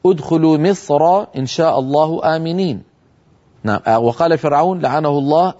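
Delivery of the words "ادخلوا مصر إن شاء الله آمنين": Quran recitation. Shown as a demonstration. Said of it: "قال تعالى".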